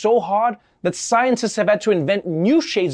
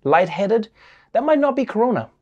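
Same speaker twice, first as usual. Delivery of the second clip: usual speaking voice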